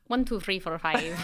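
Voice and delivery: counting in a put-on Irish accent. Shown as a demonstration. Showing co-host the only accent known, Irish. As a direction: try a rough Irish lilt. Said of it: "I only know Irish".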